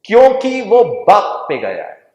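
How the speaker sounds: loud, high punchline retort; mother blaming the father, loud and high. Retold or imitated. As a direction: fire it back at the crowd, booming. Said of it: "बुलंद आवाज में".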